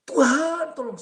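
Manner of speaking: loud, high cry for help; terrified cry. Retold or imitated. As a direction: scream to God in fear. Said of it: "Saya teriak". (jeritan ketakutan keras dan tinggi)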